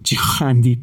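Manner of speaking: plain reading voice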